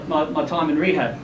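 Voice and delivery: usual speaking voice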